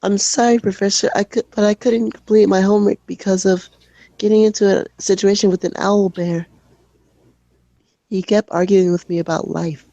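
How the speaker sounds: high, timid schoolkid apology; shy kid pleading. Acted. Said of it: "shy kid voice".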